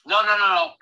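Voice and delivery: high, loud burst of no's; emphatic denial. Real, not acted. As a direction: protest sharply and fast. Quick protest to a question.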